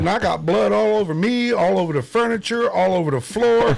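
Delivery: high, loud mock panic; hammed-up confession. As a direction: pile it on like a guilty maniac. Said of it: "I said".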